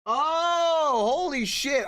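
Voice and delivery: sudden high, loud shout of shock; startled shout. Real, not acted. Streamer startled when camera falls, shouting in shock. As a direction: yelp as the camera rig gives way.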